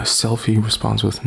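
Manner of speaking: ordinary talk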